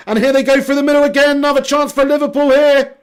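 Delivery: high, loud live football commentary; excited play-by-play. Real, not acted. Commentator calling a Liverpool attack through the middle, excited play-by-play. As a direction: lift into an urgent running call.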